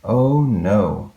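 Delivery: usual speaking voice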